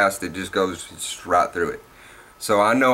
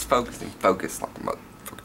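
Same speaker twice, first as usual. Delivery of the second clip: muttered whisper; 6 dB quieter than usual. Real, not acted.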